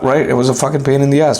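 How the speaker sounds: ordinary delivery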